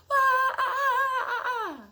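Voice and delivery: high, loud playful whooping cry; wild high whoop. Acted. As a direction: whoop like a wild thing at the rumpus. Wild rumpus noises with child.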